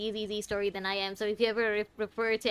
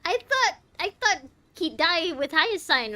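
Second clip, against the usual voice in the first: surprised; 6 semitones higher, 6 dB louder than usual. Real, not acted. Shocked reaction to plot.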